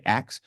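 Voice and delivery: "axe" for ask, the s after the k; Southern pronunciation. Shown as a demonstration. Presenter owning up to the audience about the slip, saying "axe" for ask. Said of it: "That's my Southern accent that just came out".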